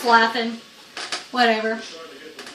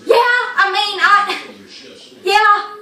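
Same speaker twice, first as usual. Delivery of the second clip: high, loud, halting stammer; imitated fluster; 8 semitones higher, 4 dB louder, slower than usual. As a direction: mimic a flustered driver faltering before police. Friend flustered before the cop.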